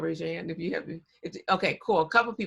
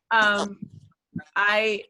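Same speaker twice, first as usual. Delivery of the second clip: usual speaking voice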